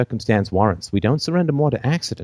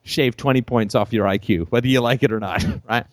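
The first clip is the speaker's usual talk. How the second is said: normal talking voice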